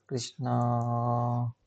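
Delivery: very slow, last vowel held long; drawn out. Real, not acted. Instructor filling in a database name, slowly drawn out. Saying the name while typing it.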